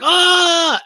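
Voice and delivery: high, loud cry of "ah"; mock scream. [name] reacting to two leopard-print customers, mock scream.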